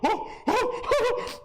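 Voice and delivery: very high, hooting "oh" bursts; exaggerated falsetto exclamations; mock freak-out cries. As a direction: whoop each "oh" high. Acts his own reaction to her panic.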